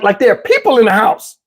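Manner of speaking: ordinary talk, nothing marked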